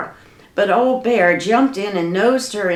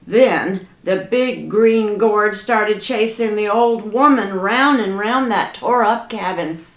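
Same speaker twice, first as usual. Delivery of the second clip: plain reading voice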